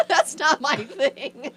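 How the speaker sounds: laughing. Real, not acted. Laughing, declining to try.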